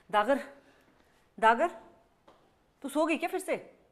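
high, loud call across the house. Real, not acted. Dagar ko awaz dete hue, calling out loud. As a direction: call her name, then check if she fell asleep again.

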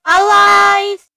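loud, high cry of triumph; excited shout. Acted. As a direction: yell it out, thrilled to be back. Shouts on coming back alive.

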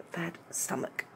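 whispered aside; soft unvoiced confession. Real, not acted. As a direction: breathe it out in a whisper. Confides she's ill.

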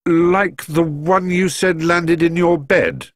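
slow, high, puzzled answer; rather confused. A narrator's reading. Paddington answering Mister Curry about the snowball, rather confused. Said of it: "sounding rather confused".

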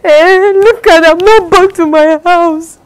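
high, loud and slow, beaming; excited showing off. Acted. Friend proudly showing off her new home, high and loud. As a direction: show off the house proudly, voice lifted high.

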